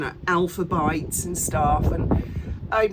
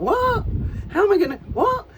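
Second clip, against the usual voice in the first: mock bewildered; 12 semitones higher, 5 dB louder than usual. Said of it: "you're like".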